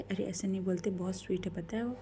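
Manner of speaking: plain reading voice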